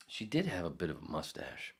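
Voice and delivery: normal talking voice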